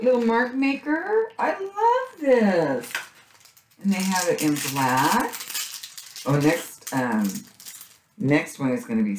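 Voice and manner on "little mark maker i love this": slow, high delighted coo over a cute find; high gushing squeal. Real, not acted. Crafter gushing to viewers over a cute sticker, high delighted coo. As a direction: melt over it in a high drawn-out voice.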